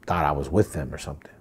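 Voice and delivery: normal talking voice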